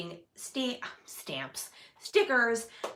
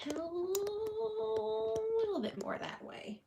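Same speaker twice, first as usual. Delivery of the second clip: drawn out much higher and slower; high slow coaxing voice; 11 semitones higher, 6 dB quieter, much slower than usual. Shown as a demonstration.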